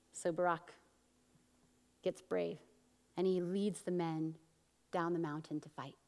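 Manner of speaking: normal talking voice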